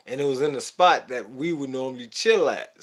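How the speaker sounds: ordinary talk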